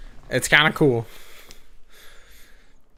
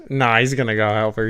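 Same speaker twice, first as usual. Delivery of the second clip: usual speaking voice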